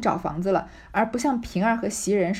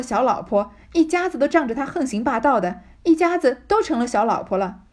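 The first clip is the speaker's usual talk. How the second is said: furious scolding; 3 semitones higher, 4 dB louder than usual. Acted. Yuanyang curses her scheming sister-in-law, loud and furious. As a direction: let her rip into her sister-in-law, voice rising. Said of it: "指着他骂道".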